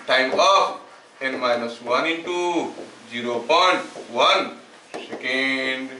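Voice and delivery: slow, spaced-out dictation; note dictation. Shown as a demonstration. Teacher dictating a formula for students to write, slow dictation. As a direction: dictate the formula piece by piece, slowly.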